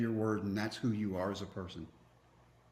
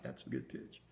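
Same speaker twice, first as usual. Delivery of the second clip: breathy whisper to himself; whispered thought; 10 semitones lower, 12 dB quieter than usual. Retold or imitated. To himself on the mound, a pleased whisper. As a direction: whisper it, quietly admiring the pitch. Admiring his own pitch, inner thought.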